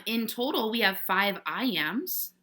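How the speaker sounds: ordinary talk, nothing marked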